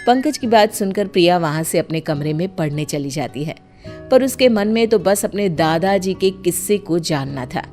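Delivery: usual speaking voice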